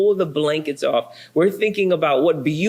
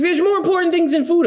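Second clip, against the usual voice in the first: high, fast, louder mock wife; imitating wife; 13 semitones higher, 3 dB louder, faster than usual. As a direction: squeak out a quick indignant wife. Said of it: "She's like".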